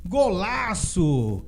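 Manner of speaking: loud high word-by-word goal recap; excited goal recap. Real, not acted. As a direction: punch each word, stretched out.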